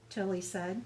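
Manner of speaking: ordinary delivery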